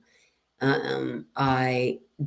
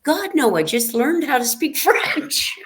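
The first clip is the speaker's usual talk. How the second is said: much higher, louder mock-horrified no; half-laughing, much higher, louder God, no; retold horrified refusal; 12 semitones higher, 3 dB louder than usual. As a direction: recoil at the offer, pitched way up and laughing. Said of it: "And I'm like".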